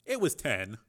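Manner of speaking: usual speaking voice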